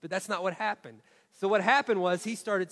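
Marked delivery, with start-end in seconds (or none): none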